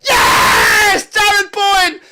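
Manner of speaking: high, full-volume goal celebration; ecstatic shout. Real, not acted. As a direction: roar the scorer's name in triumph.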